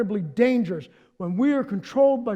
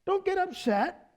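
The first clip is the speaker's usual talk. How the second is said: soothing Jesus; 7 semitones higher, 4 dB quieter than usual. Retold or imitated. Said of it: "And Jesus is like".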